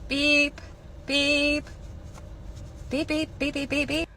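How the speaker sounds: high, light beep noises; scanner beeps. Acted. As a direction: chirp like a barcode scanner. Cashier imitating scanner.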